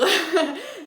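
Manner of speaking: a rising amused giggle; short laugh. Real, not acted. Booktuber joking about a slow sequel, rising giggle. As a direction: giggle at your own drama.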